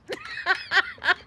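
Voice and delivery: high laughing burst; real giggling fit; amused laughter. Real, not acted. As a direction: crack up at your own teasing. Laughing at teasing of dad.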